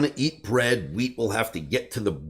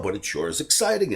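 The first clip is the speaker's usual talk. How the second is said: ordinary delivery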